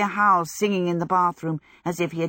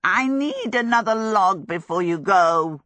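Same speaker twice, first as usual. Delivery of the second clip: demanding fire demon; 3 semitones higher, 5 dB louder than usual. A narrator's reading. Calcifer demanding a log from Sophie, loudly insistent. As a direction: call out like a needy little demon.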